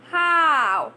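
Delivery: How stretched with a wide open mouth; open-mouth demo. Shown as a demonstration. Teacher showing students an open mouth on How. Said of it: "Let it open".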